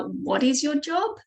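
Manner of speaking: ordinary talk, nothing marked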